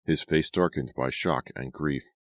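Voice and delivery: ordinary delivery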